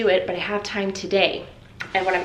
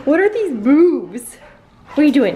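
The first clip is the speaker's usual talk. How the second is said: doggy talk; 6 semitones higher, 8 dB louder than usual. Real, not acted. Owner cooing at her excited dogs, high and loud. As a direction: coo at the wriggling dogs.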